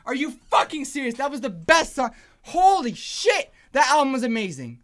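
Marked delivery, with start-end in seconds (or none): 2.4-3.5 higher, slow, drawn-out swearing; awed exclamation. Real, not acted. Fan floored by the album, awed exclamation. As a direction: stretch both words out in amazement.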